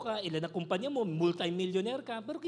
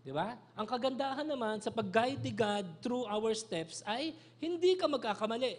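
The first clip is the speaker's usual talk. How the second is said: ordinary delivery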